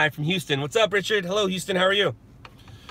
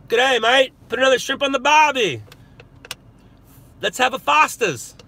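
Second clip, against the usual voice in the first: hammed-up Australian accent, higher and louder; mock Australian accent; 10 semitones higher, 7 dB louder than usual. Greeting Australian viewers in a mock Australian accent. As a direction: ham up a cartoon Australian accent. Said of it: "I'm not supposed to do this".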